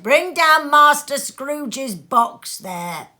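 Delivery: loud, slow bellow down the hall; terrible cry. A narrator's reading. The schoolmaster shouts an order down the hall, loud and slow. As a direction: boom it out like a stern master. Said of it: "A terrible voice in the hall cried".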